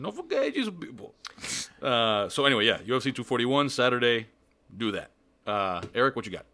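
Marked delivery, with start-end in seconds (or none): 0.0-1.1 put-on higher Cuban accented echo; one more Cuban accented line. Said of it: "Best Cuban accent".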